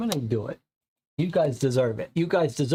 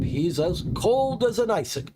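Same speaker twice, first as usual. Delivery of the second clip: high first take; 7 semitones higher than usual. Shown as a demonstration.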